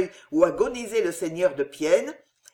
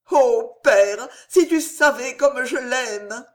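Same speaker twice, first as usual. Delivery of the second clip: higher, louder desperate pleading; pleading daughter; 6 semitones higher, 4 dB louder than usual. A narrator's reading. Daughter on her knees begging her father's forgiveness, pleading high and loud. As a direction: beg your father on your knees in tears.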